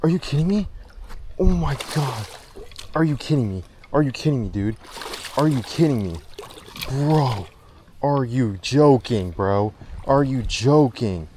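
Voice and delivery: can't believe the catch; excited disbelief. Real, not acted. Big fish just hit.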